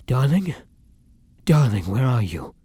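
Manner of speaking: loud, worried call; alarmed, searching call; anxious calling. Acted. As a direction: call out for a missing loved one. Wakes and calls for missing partner.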